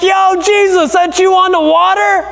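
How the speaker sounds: high, casual voice calling out to Jesus; casual Peter. Retold or imitated. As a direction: call out like a buddy. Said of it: "He's like".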